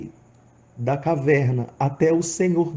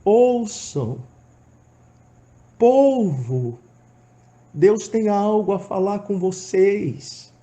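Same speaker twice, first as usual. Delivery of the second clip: shouted call; 5 semitones higher, much slower than usual. Retold or imitated. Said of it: "eu continuava gritando".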